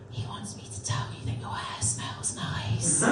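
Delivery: put-on breathless whispered kid voice; creepy child whisper. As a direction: whisper it like a spooky secret. Child relays the wall man.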